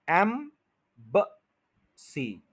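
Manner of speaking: एम्बेसी as "em... buh... see", long gaps; syllable by syllable. Shown as a demonstration. Teacher slows the word for learners, separated syllables. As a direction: break एम्बेसी slowly into three. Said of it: "एम के ऊपर stress है".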